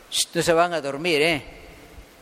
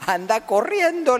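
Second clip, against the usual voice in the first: lightly acted urgent order, pitched up; urging; 7 semitones higher than usual. Rabbit sends the freed boy for the hunter, urging.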